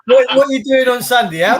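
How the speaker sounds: high, loud, laughing ask; laughing question. Real, not acted. Friend asking about Sunday plans through laughter. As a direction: ask while still cracking up.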